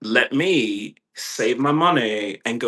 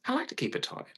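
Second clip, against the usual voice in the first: breathy joking whisper, high and hushed; 14 semitones higher, 9 dB quieter, much faster than usual. Real, not acted. Teasing aside to the viewers, high hushed whisper. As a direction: confide it under your breath.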